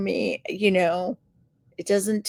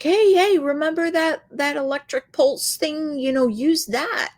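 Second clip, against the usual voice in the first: excited husband; 7 semitones higher than usual. Retold or imitated. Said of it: "so he's like".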